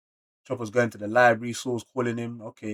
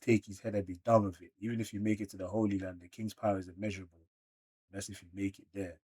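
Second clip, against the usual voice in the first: reading a manga line quietly; 2 semitones lower, 10 dB quieter than usual. Acted. Reads character lines quietly.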